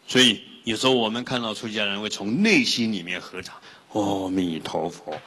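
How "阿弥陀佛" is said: reverent greeting. Retold or imitated. Monks greeted with joined palms.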